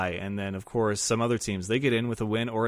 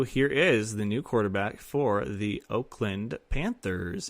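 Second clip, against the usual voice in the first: normal talking voice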